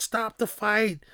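high pleading cry to stop; frantic wife. Retold or imitated. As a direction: beg them to stop it, high. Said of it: "Apollo's wife is like".

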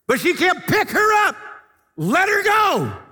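loud shouted order. Retold or imitated. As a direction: hammer the order home. Exchange with ladies, shouted.

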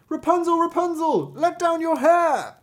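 high, loud sing-out call. A narrator's reading. King's son calling up to Rapunzel, high loud cry. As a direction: call up to the top of a tower. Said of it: "the king's son came and cried".

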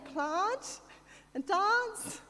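"plant" and "dance" drawn out in mock-posh Adelaide accent. Sister pointing out her picked-up accent, exaggerated posh Adelaide vowels. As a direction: mimic a posh South Australian accent, high and slow. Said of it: "I've picked up the accent".

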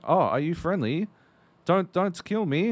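put-on high-pitched German-accented plea; mock German accent. German player begs stranger for mercy, mock German accent. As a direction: imitate a nervous German gamer. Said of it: "which is why I'm doing the accent".

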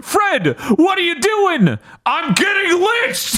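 high, hammed-up cartoon yelling; mock frantic shouting. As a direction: call it out high, imitating two panicked friends. Acted shouted exchange from the film.

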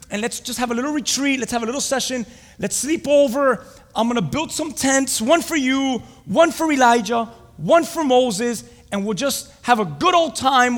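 high, loud, eager proposal; Peter. Acted. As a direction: excitedly sell the plan, punching each item. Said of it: "What did Peter want to do there".

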